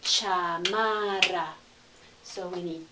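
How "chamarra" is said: very slow, drawn-out "Chamarra" with Spanish vowels; syllable by syllable. Shown as a demonstration. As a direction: stretch out "Chamarra" for learners. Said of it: "a three-syllable word".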